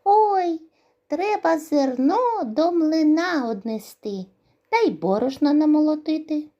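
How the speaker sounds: high, loud squeaky mouse voice; eager mice. Acted. Mice want the grain taken to the mill, high squeaky voice. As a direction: chirp it like excited little mice. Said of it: "Прибігли мишенята".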